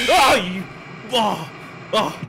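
startled. Real, not acted. Jump scare reaction.

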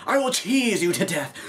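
hammed-up shouted threat; playful villain yelling a silly threat; mock robber threat. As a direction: growl it like a cheesy villain with a spray can.

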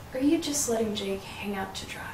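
bitter whisper. Acted. Bitter accusation in argument.

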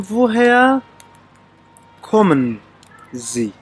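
"وۆ هەیە کۆمن زی" said word by word with long pauses; word-by-word demo. Shown as a demonstration. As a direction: teach "وۆ هەیە کۆمن زی" in separated pieces, pausing before each. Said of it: "دەبێ بڵێن".

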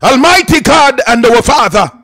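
usual speaking voice

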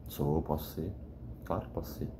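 quiet half-whispered confession; hushed admission. Real, not acted. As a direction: drop to a whisper as if admitting it to yourself. Quiet self-admission after denial.

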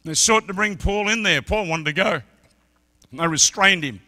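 loud, high-pitched sermon climax; full-force preaching. Acted. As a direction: preach it out, building to the roar.

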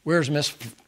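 usual speaking voice

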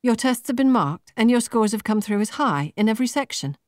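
a light voicing of an upbeat recruiter; perky young businesswoman. A narrator's reading. Laura telling Marta her scores, perky and high.

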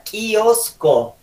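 "kiosco" con la K bien explosiva; K marcada. Shown as a demonstration. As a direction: haz notar la K al empezar "kiosco". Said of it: "Tiene que notarse la K". ("kiosco" con ka fuerte)

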